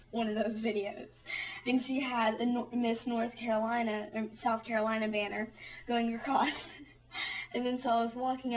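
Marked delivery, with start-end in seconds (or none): none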